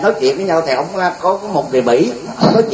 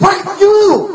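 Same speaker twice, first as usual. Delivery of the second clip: high furious outburst. Retold or imitated. American finally losing it, high shouted laughing curse. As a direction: blow up at him, half laughing. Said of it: "tức quá rồi, bắt đầu kìa".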